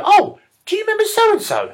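very high sing-song question; high mock woman. Woman asking boyfriend if he remembers, high mock voice. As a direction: pitch up as a chatty girlfriend.

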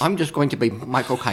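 lightly voiced mock British accent; a hint of Michael Caine's British accent. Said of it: "go with that accent".

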